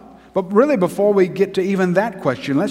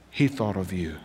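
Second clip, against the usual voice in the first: much softer and lower, intimate; tender pulpit hush; hushed sermon; 8 semitones lower, 8 dB quieter than usual. Acted. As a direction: lean in and speak it gently. Soft answering half.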